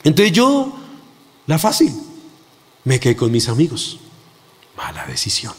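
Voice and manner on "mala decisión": soft confessing whisper; whispered aside. Real, not acted. Preacher admits his mistake to the church, whispered.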